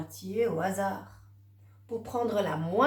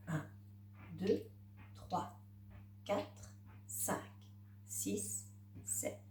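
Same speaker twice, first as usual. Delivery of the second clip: counting papillons under the breath, softly; 3 semitones lower, 15 dB quieter, slower than usual. Acted. Teacher counting butterflies for the child, soft and murmured. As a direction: murmur the numbers one by one as you point.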